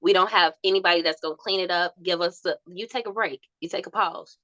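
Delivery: usual speaking voice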